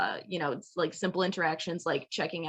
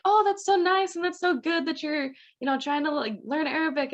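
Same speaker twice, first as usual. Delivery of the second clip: high, louder gushing praise, imitating the locals; delighted cashier; 10 semitones higher, 4 dB louder than usual. Cashiers praise the student for learning Arabic, high gushing imitation. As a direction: gush it high and bright, a lightly played delighted local. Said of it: "was like".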